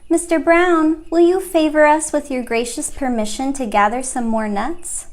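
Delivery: polite request. A narrator's reading. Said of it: "and said".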